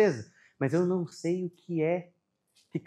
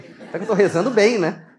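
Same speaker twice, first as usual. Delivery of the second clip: louder, laughing through the joking question; laughing joke; 3 semitones higher, 8 dB louder than usual. Retold or imitated. Father jokes with a laugh about his daughter sleeping late. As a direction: tease yourself with a laugh.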